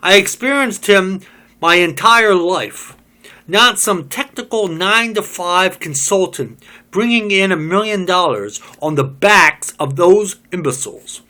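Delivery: loud, high, bitter tirade; bitter rant. Acted. Job ranting to the group about Smith, loud and bitter. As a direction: spit out the resentment.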